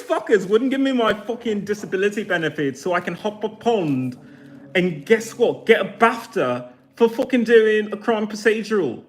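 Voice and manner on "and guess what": usual speaking voice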